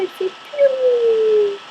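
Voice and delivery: high, slow little piou; cute sad piou. Shown as a demonstration. Showing viewers how cute a sad bird sounds, a high slow piou. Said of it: "ça fait trop cute".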